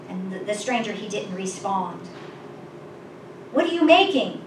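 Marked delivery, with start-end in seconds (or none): 3.5-4.4 higher, louder, uneasy question; nervous shout. A narrator's reading. Another cave dweller questions the stranger, nervously shouting. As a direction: call it out nervously, half-shouted. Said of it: "another shouted nervously".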